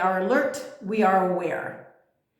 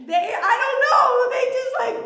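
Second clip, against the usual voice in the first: words spilling out through high laughter; laughing through the words; 3 dB louder, faster than usual. Real, not acted. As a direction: talk while still laughing.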